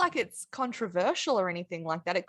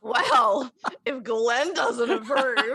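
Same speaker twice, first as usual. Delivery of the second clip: laughing tease; 3 semitones higher, 6 dB louder, much slower than usual. Real, not acted. Teasing the host.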